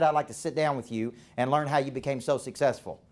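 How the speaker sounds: normal talking voice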